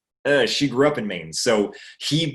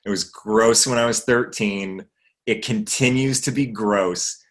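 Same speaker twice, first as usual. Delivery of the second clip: ordinary talk, nothing marked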